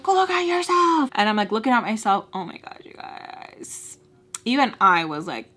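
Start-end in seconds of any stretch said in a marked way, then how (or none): 0.0-1.1 imitated yell, high and loud; hammed-up furious order, pitched way up; screaming. Angry man pulling down the visor, yelling. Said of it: "he's, like, screaming". 2.4-4.0 slow, hushed, breathy aside; appalled. Real, not acted. Confiding to viewers about her reflection, appalled hush.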